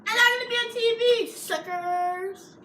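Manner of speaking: loud, high gloating brag; excited boasting. Real, not acted. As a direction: crow it over your friends. Kid boasting about being on TV.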